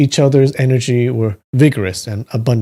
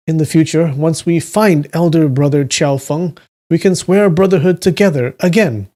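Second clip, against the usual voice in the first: higher, boldly tipsy voice; wine-fuelled boldness, pitched up; drunk voice; 6 semitones higher than usual. A narrator's reading. Drinker proposing sworn brotherhood to a new friend, boldly drunk. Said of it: "You like my drunk voice?".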